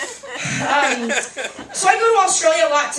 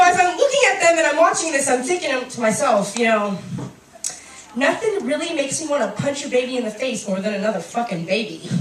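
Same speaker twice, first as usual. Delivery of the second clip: usual speaking voice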